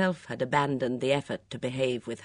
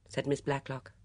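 ordinary delivery

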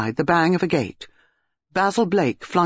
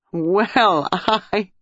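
laughing; 4 semitones higher than usual. A narrator's reading. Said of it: "Dinah stopped and laughed".

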